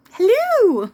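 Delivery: put-on very high, loud old-lady shriek; Mrs. Doubtfire. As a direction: burst out a shrill, high granny greeting. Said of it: "Go watch Missus Doubtfire".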